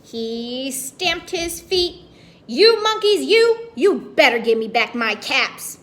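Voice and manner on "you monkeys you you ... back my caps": stamp and shout at the monkeys; angry shout. Acted. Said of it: "Now he felt quite angry".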